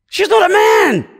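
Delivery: a sudden loud preacher's cry; emphatic shout. Real, not acted. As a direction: shout it out high and loud from the pulpit.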